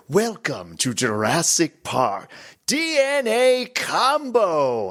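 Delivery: very drawn-out announcer call, climbing high at the end; hammed-up, slow jackpot call; game announcer imitation. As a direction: stretch it like a theme-park pinball announcer. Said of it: "the guy that's really good".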